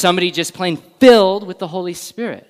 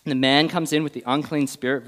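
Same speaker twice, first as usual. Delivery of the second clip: usual speaking voice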